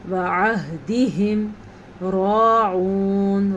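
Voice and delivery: راعون with a heavy ra and long aa, slow; full-mouthed ra. Shown as a demonstration. Teacher showing the heavy ra in راعون. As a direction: chant وعہدہم راعون slowly. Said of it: "را کو پر پڑھنا ہے".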